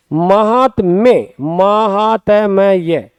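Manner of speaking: महात्म्य said very slowly, syllable by syllable, ma-haat-mye; महात्म्य drawn out at a teacher's slow pace; slow spelled-out word. Shown as a demonstration. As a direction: sound out महात्म्य slowly for students. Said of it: "शब्द होता है महात्म्य".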